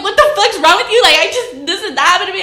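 high, loud protesting imitation; mock-outraged boyfriend defending himself; indignant boyfriend. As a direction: mimic him whining back in outrage. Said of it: "he was all like".

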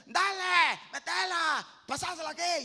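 loud, high calls from teammates on the pitch; high shouting from the sideline. Acted. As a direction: yell it like a teammate demanding the ball. Shouting players on the field.

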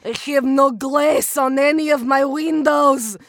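slow, loud, heavily accented character; mournful put-on foreign accent, drawn out; Olia. Shown as a demonstration. Actor doing Kate McKinnon's Olia, slow accented lament. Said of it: "Kate McKinnon and O-, Olia?".

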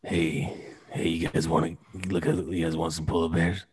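low, hushed creep voice; creepy dude. Pretend. Creep offering polar bears to passers-by, creepy. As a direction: speak softly and low, like a creep luring people. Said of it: "What, talking creepy?".

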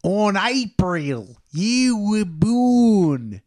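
drawn-out, hammed-up Australian character voice; Johnny Australia. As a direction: stretch every vowel in a cartoon Aussie accent. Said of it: "with the Johnny Australia voice".

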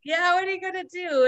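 high, mocking challenge; retold taunt, pitched well up. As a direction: imitate a teasing dare. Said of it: "the girls are basically taunting".

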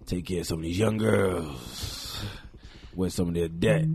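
put-on low, drawn-out older-man act; put-on daddy voice. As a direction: lightly play a slow-talking sugar daddy. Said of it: "I'm talk just like this".

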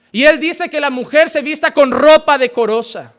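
ordinary talk, nothing marked

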